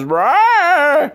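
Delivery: high loud yelp of fright; mock startled shriek. Crazy woman reacting to a shock, loud mock shriek. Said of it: "Crazy woman that goes".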